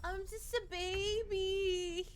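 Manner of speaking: high, loud, slow fake sob; hammed-up baby whimper; mock crying. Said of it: "repeats line sung".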